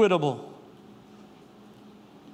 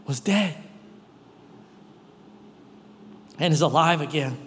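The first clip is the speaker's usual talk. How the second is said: disappointed father; 6 semitones lower than usual. Retold or imitated. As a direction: speak gently, heavy with disappointment. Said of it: "what disappointment sounds like with your father".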